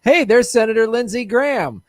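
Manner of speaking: playful Southern hail; Southern accent. Pretend. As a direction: put on a hammed-up Southern accent. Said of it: "It's, like, Southern".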